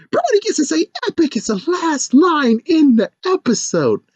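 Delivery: high, squeaky mock-writer voice; eager pleading. Writer asking for her favourite last line, squeaky. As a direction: press the request in a squeal.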